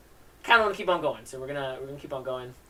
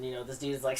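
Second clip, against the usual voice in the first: ordinary delivery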